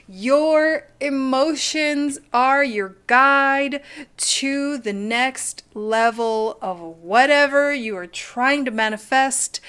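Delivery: slowed oracle message to viewers; oracle reading. Acted. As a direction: read it out like an oracle. Reading the stone message slowly.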